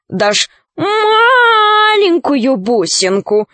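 high, slow pleading; wheedling. Acted. Crow wheedles for a bead.

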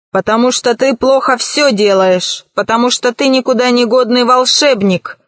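plain reading voice

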